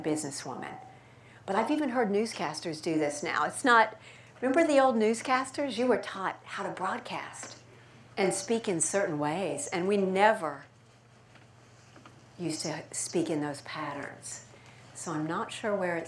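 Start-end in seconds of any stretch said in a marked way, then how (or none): none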